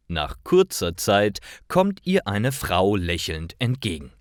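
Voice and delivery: ordinary talk, nothing marked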